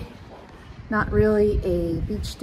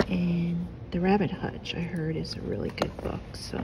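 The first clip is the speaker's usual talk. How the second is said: library whisper; 2 semitones lower, 7 dB quieter than usual. Real, not acted. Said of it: "kind of whispering 'cause I am in the library".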